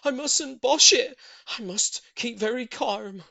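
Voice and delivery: loud, high, slowing to calm; steady your nerves; nervous excitement. A narrator's reading. Voices Mister Hoppy, nervous.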